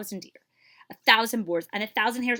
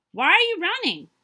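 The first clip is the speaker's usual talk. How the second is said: loud, high call; louder, higher call across the grove; 11 semitones higher, 9 dB louder than usual. Acted. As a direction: call out to a hare racing past. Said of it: "he called out to her".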